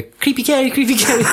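high sing-song taunt; lightly mocking sing-song chant. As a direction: chant it like a playground tease. Mocking chant quoted from film.

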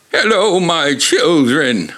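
put-on high, slow croak of an old grandpa; croaking old man. A narrator's reading. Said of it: "Archie croaked".

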